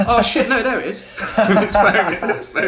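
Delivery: high, loud, laughing through the words. Retold or imitated. Tom finds his phone after the brawl, laughing high and loud. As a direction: crack up at the absurd reveal.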